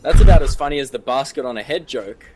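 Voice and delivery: ordinary delivery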